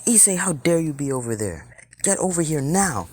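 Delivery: high-pitched, indignant scolding; indignant command. Acted. Ria ordering Issei back over to her, indignant high voice.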